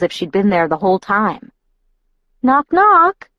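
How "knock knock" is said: high and bright, grinning; playful grin. A narrator's reading. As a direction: tease it with a grin. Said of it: "she added with a grin".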